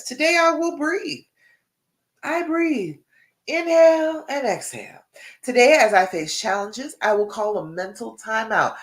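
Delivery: high, bright read-aloud of an affirmation card. Shown as a demonstration. Coach reading the daily affirmation card to her viewers, high and bright. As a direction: lift it up like reading a cheerful card. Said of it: "this card today says".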